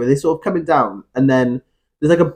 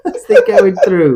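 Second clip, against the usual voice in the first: laughing; 5 semitones higher, 4 dB louder, slower than usual. Real, not acted. Laughing after own impression.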